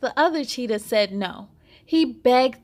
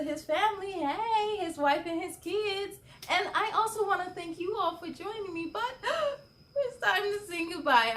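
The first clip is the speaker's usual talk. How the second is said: real laughing cry with a gasp; can't hold it together, laughing; laughing through tears; 6 semitones higher, 8 dB quieter than usual. Real, not acted. Host breaking up while saying goodbye.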